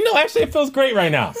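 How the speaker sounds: high-pitched mock sweetness; sarcastic, as if labor were pleasant; sarcastic mock answer. Mom answering husband's dumb question, high sarcastic mock.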